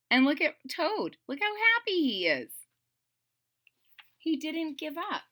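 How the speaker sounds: ordinary delivery, nothing marked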